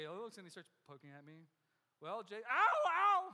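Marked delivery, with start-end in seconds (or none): 2.0-3.2 sharp rising ow, much louder and higher; yelping in pain. Retold or imitated. Patient yelping as the surgeon pokes his sore belly. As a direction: yelp as the finger jabs.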